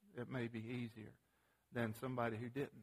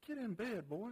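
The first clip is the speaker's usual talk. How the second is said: higher-pitched mock scold of a little boy; retold scolding; 7 semitones higher than usual. Father retelling scolding his son back to bed, mild mock sternness. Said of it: "So I scolded him pretty strong".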